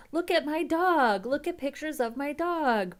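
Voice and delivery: high, gushing dog-owner voice; mocking dog owner. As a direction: mimic a doting dog owner. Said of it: "one of those people that's like".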